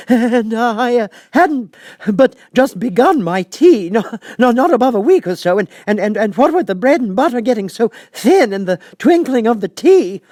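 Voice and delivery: high, loud quavering stammer; trembling voice. A narrator's reading. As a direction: stammer it out in terror. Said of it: "in a trembling voice".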